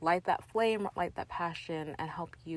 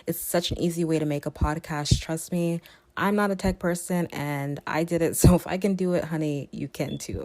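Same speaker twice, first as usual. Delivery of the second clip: upbeat, louder sponsor read, a bit pushed; 8 dB louder than usual. Acted. Podcast host reading a sponsor spot to listeners, upbeat ad voice. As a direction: sell the app like a friendly host ad.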